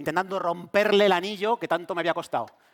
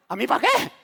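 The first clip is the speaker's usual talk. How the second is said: mock alarm; 5 semitones higher, 5 dB louder than usual. Mock-reluctant husband.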